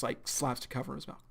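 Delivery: plain reading voice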